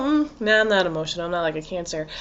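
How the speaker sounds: soft, low brush-off of an imitated Cancer; mock dismissive denial. Imagined Cancer denying being emotional, dismissive. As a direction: trail down quietly as if shrugging it off.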